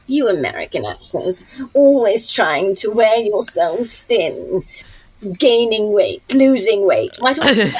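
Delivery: slow, disdainful British dame; Maggie Smith. Retold or imitated. Veteran co-star scolding American actress, withering British impersonation. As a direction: lecture them with drawn-out English hauteur. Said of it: "that's the best Maggie Smith".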